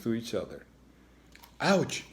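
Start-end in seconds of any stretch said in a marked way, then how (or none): 1.6-2.0 high squeaked ouch; a small pained squeak. A narrator's reading. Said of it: "she squeaked".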